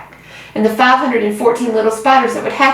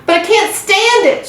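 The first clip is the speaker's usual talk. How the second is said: high, desperate shout; high distraught shout; 11 semitones higher than usual. A narrator's reading. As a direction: cry it out against leaving her. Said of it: "shouted Wilbur".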